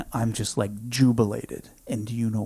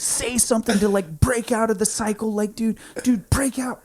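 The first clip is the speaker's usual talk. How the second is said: urgent high voice; 10 semitones higher, 4 dB louder than usual. Retold or imitated. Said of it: "I'm like".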